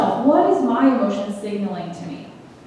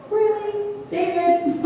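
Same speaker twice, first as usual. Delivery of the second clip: high, amused disbelief; can't believe what David is saying; incredulous; 10 semitones higher than usual. Real, not acted. Speaker reacting to David's lament with amused disbelief.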